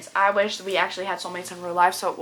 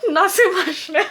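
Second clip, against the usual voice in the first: laughing; 10 semitones higher, 6 dB louder than usual. Real, not acted. Laughing at own stumbles.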